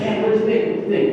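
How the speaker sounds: fishing excitedly for his dad's verdict; eager question. Retold or imitated.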